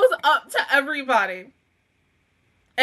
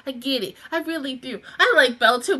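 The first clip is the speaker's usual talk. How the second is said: ordinary talk, nothing marked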